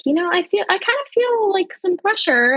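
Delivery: pitched well up, uncertain and urgent; high worried report. Retold or imitated. Laboring mother telling nurses she feels pressure, high and worried. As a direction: voice climbing as the pressure hits. Retold waking up feeling pressure.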